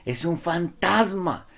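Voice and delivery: high, slow mock scream of fear; a retold terrified yell; terrified cry. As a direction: cry out like a frightened disciple. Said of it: "que gritaron aterrados de miedo".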